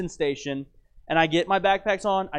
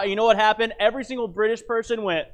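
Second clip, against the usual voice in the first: ordinary delivery, nothing marked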